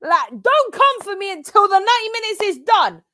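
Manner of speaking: high, fired-up challenge; excited high-pitched taunt; taunting call. Real, not acted. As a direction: crow it defiantly at the rivals. Taunting rivals during the match.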